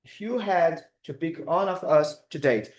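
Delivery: "If you had to pick one of us to date" read out slowly for the chat; slow, careful teacher read of the sentence; slow dictation. Shown as a demonstration. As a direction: read slowly so students can write it down. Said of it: "Coloca aqui no chat".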